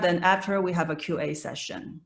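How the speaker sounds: normal talking voice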